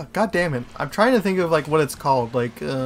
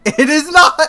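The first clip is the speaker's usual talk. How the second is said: high, loud denial; indignant shout; 10 semitones higher, 7 dB louder than usual. Real, not acted. Arguing biscuit versus cookie, indignant shout. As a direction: fire it back indignantly.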